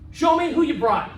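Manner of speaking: usual speaking voice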